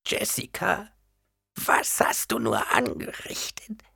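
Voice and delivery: geflüstertes Wehklagen einer Greisin; jammernd. A narrator's reading. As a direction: klagend gehaucht. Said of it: "jammerte die alte Frau". (alte Frau jammert flüsternd zu Jessica)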